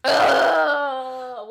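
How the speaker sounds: disgusted groan. Real, not acted. Disgust at letter line.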